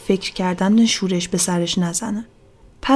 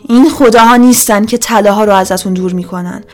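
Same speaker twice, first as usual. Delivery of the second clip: loud, forceful oratory to a crowd; 2 semitones higher, 8 dB louder than usual. A narrator's reading. Magdalena to the silent villagers, loud accusing proclamation. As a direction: proclaim it loudly, easing off at the end. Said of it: "با صدای بلندی گفت".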